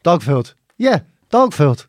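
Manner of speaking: deadpan guard. Retold or imitated. Imitating guard's deadpan repetition.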